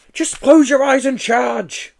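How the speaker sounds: loud slow high urging; rally him to charge. Acted. Joe urging the Yeti through the doors, loud slow urging. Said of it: "Joe advised".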